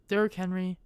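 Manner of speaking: normal talking voice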